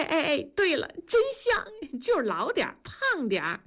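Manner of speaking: a wife delighted at her husband's likeness; playful wife. Acted. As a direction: tease him while styling his hair. Wife styles husband like the actor.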